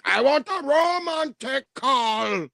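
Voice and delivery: high, slow, hammed-up dancehall singing. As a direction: croon it like a mock dancehall diva. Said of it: "That bitch like".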